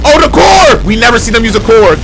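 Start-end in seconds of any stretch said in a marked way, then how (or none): 0.1-0.8 high, startled cry of excitement; excited shout. Real, not acted. Streamer spotting the core in the video, high excited shout. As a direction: shout it as a sudden discovery. Surprised by a weapon reveal.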